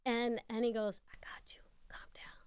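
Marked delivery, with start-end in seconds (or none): 1.1-2.4 a soft, secret whisper. Retold or imitated. [name] whispering to calm her in the limo. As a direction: whisper it so only she can hear.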